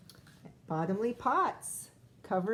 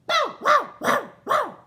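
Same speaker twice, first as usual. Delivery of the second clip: high, loud bow wows; loud barking dog; 13 dB louder than usual. Acted. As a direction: bark like a big excited dog. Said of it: "said Bitzer Maloney".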